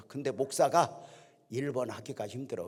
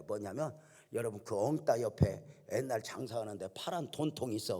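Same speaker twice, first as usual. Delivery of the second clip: ordinary talk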